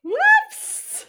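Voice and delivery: squeaky high whoops; high surprised yelp. Real, not acted. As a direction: yelp it out, caught off guard. Host flubs the intro.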